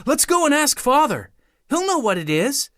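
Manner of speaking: put-on high, eager child's voice; a small boy bursting to tell his father; excited young boy. A narrator's reading. Douglas as child character.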